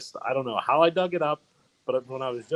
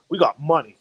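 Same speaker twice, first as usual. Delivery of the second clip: retold boast, louder and higher; punchy mock bragging; imitating a boast; 4 semitones higher, 8 dB louder than usual. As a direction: crow it like a friend bragging. Retelling friend's boastful punchline.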